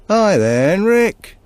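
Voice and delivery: bright, high, loud hail; mock-friendly greeting called out high and loud; cheery greeting. A narrator's reading. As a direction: call out a smiling hello across the snow. Brothers greet Henrik, smiling.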